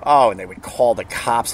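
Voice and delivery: usual speaking voice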